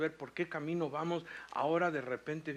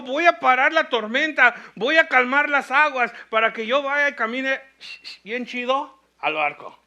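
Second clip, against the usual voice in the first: loud, high, hammed-up boast; mock preacher shout; 9 semitones higher, 18 dB louder than usual. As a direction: belt it out as a mock Jesus. Mock quote of what Jesus didn't say.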